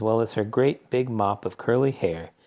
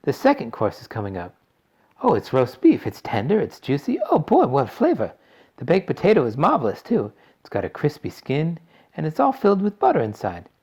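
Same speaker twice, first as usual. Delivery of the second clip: put-on excited girl voice; 6 semitones higher, 4 dB louder than usual. A narrator's reading. Violet raving over food.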